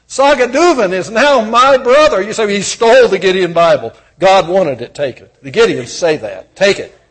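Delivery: loud, high revival preaching; fiery preacher in full flow; preaching at full force. Acted. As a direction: proclaim it to the whole hall.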